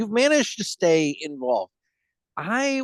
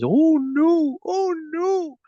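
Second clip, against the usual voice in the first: high squeaky voice; 4 dB louder, much slower than usual. Retold or imitated. Monkey meme voice again.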